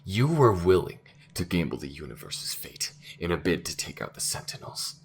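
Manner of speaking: loud, incredulous confrontation; outraged accusation. Acted. Crew member confronting the AI over its gamble, loud outrage. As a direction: throw the accusation at them, appalled.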